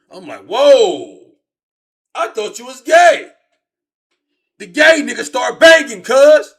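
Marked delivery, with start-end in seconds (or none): 0.5-3.3 much higher and much louder stunned yell; startled shout. Retold or imitated. Shocked reaction in story. 4.6-6.5 much higher, much louder hyped narration; excited shout. Real, not acted. Excited retelling of twist.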